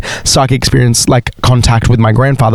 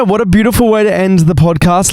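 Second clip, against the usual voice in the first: normal talking voice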